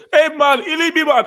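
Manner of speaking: high, loud mocking fake Jamaican accent. Schoolmate mocking a Nigerian boy, high loud fake Jamaican. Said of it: "I was just say".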